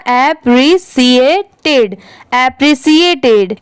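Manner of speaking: appreciated spoken very slowly, part by part; slow syllable by syllable. Shown as a demonstration. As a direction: break appreciated into slow pieces. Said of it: "पूरा क्या हो जाएगा".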